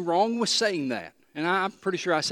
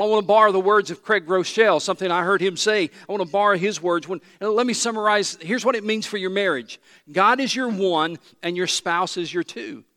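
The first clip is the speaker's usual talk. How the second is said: normal talking voice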